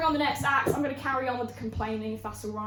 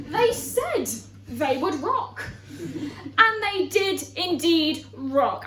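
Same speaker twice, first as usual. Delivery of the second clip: slow, emphatic, raised proclamation; mock declaiming; 5 semitones higher, 5 dB louder, much slower than usual. Comedian defending the rock show, slow mock-grand declaiming. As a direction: proclaim it word by deliberate word.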